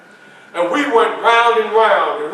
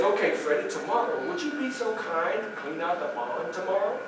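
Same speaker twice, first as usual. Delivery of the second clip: soft, low, courteous request; master's gentle politeness; 4 semitones lower, 12 dB quieter than usual. Acted. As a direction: imitate the master asking ever so nicely. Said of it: "Notice how he said that".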